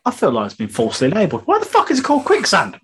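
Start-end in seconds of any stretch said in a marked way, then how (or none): 1.5-2.8 high, outraged disbelief at the name; incredulous outburst. Real, not acted. Asking the co-host why quicksand is called that, in high incredulous disbelief. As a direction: pitch it up like you can't believe it.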